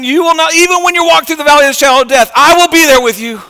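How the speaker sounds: high, loud, ringing preacher's promise; impassioned promise. Acted. Jesus promising presence through the valley of death, impassioned and high. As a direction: voice Jesus with full preaching force.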